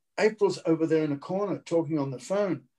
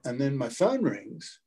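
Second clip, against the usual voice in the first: normal talking voice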